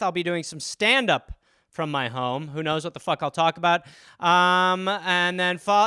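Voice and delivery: ordinary delivery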